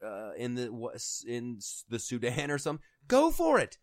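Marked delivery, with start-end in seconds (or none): 3.1-3.8 very high, loud mock outburst; exasperated yell. Telling Democrats to help by themselves, exasperated yell. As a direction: yell it as a fed-up challenge. Yelling at would-be do-gooders.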